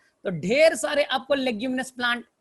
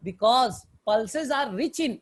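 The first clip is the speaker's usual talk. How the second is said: very slow, word by word dictation; slow it right down so they can write; dictating slowly; much slower than usual. Shown as a demonstration. Teacher dictating notes to the class, very slow.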